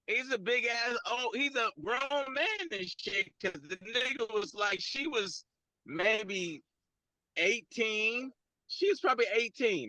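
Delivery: normal talking voice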